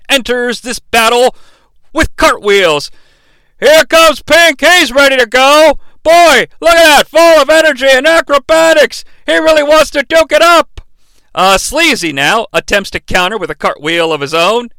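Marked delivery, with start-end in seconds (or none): 3.6-10.8 high, loud hype call; excited announcer. Acted. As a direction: sell the entrance like a ringside announcer. Hyping a wrestler's entrance.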